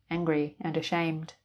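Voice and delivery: plain reading voice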